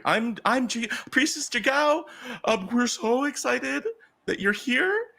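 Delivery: high, gushing hostess voice; rally the crowd as a thrilled priestess; very high-pitched, eager welcome; excited priestess. Acted. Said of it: "she's the most excited at all".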